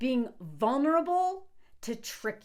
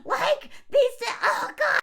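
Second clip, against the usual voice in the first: exasperated outburst; 11 semitones higher, 3 dB louder than usual. Real, not acted. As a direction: wail it in sheer exasperation. Overcome with exasperation at two men.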